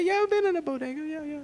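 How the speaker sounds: high, light voice; suddenly going high and light. Real, not acted. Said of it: "when a man get light voiced it like that".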